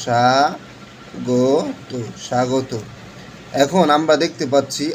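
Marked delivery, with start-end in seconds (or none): 0.1-2.7 স্বাগত stretched very slowly, "shagot", soft; drawn-out pronunciation demo. Shown as a demonstration. Teacher to class, stretching স্বাগত out very slowly as "shagot". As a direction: draw স্বাগত out syllable by syllable as "shagot". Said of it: "তালিবস্য করে দেব".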